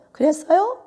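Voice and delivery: high, lifted, rising question; lively high-pitched reply. Shown as a demonstration. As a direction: lift the voice right up at the end. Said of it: "틀리들 하는 거지".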